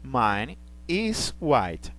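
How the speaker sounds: slow, careful English example; slow teaching demo. Shown as a demonstration. Teacher reading an example to students, slow and careful. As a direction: spell the sentence out slowly for the class. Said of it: "Veja que vem o verbo be".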